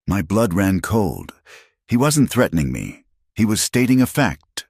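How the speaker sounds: plain reading voice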